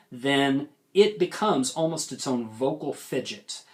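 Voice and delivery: normal talking voice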